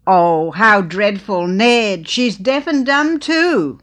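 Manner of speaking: loud, slow, high dismay; aghast lament. Acted. Old lady to husband Ned, loud, slow dismay. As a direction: drag it out in horrified pity.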